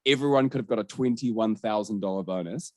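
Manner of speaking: ordinary talk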